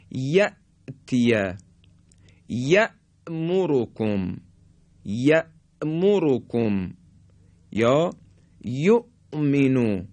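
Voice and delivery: یأتیه، یأمرکم، یؤمنو slowly, stopping dead on the hamza; sharp still hamza. Shown as a demonstration. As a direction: snap shut and hold at the hamza in یأمرکم and یؤمنو. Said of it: "این طرز تلفظ همزه در حالت سکون". (یأمرکم با همزهٔ ساکن تیز و مکث)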